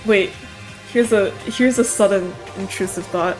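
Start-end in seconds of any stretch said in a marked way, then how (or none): none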